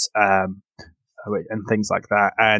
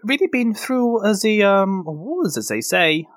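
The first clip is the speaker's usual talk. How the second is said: higher, fussy healer voice; Vandy the priestess; 9 semitones higher than usual. Acted. Priestess to the concussed hero, higher fussy voice. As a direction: reassure the patient kindly.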